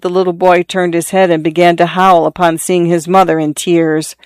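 ordinary delivery, nothing marked

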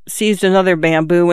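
ordinary delivery

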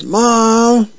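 high, drawn-out call for mom; mock yell. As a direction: call from another room, hammed-up. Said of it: "somebody yells".

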